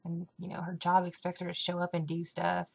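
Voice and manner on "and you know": ordinary talk, nothing marked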